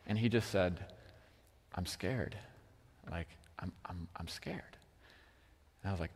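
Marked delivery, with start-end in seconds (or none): 1.7-4.8 small, low, soft voice; hushed, timid imitation of a kid; scared little boy. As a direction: imitate a child quietly admitting fear. Voicing his scared little son.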